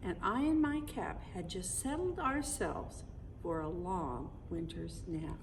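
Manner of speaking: lilting read-aloud poem voice; storybook narration. Acted. Parent reading the Christmas poem to the kids, lilting storybook narration. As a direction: read the rhyme to the children like a bedtime story.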